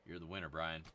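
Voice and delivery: normal talking voice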